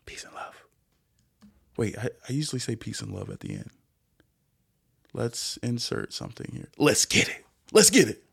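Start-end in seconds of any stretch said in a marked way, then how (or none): 0.1-0.6 very soft, whispery farewell; hushed sign-off. Real, not acted. Sign-off slipped out too early. 6.8-7.4 whispery catchphrase; whispered hype. Pretend. Trying out an inserted catchphrase. 7.7-8.2 squeaky, high catchphrase; high-pitched hype. Pretend. Second take of inserted catchphrase.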